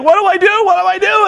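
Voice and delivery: high, frantic, mock-panicked question. Abyss panicking over beaten Hogan, high mock panic. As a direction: look around lost and scared. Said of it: "he's like".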